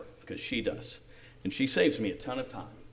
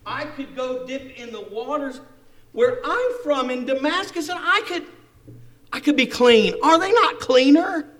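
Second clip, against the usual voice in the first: raised, higher-pitched protest; indignant Naaman; 11 semitones higher, 13 dB louder than usual. Acted. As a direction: argue hotly with offended pride. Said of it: "Now he's mad".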